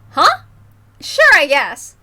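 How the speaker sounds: high loud startled huh, then a shrug; surprised huh. Acted. Mion startled by Keiichi's request, then agreeing. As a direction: sound caught off guard.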